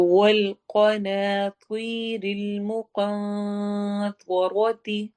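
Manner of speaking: very slow chanted tajweed recitation; teacher reciting verse for students; Quran recitation. Shown as a demonstration. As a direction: intone each syllable slowly.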